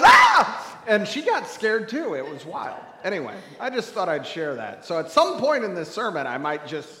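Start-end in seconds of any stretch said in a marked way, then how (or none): 0.0-0.8 very high, loud shriek; startled scream. Retold or imitated. Daughter scared in return, shrieking back. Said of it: "And she goes".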